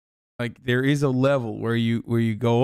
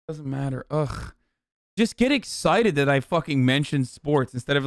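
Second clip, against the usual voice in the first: ordinary talk, nothing marked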